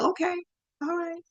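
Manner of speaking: small, high-pitched imitation of dad backing down; retold reluctant okay, high and soft; giving in. Dad relents after daughter says stay, high soft okay. Dad gives in, acted quote.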